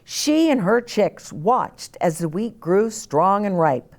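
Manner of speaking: ordinary delivery, nothing marked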